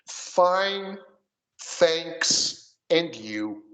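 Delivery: "fine, thanks, and you" spoken slowly, word by word; careful model. Shown as a demonstration. Teacher models the standard answer for the student. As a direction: separate the three words clearly.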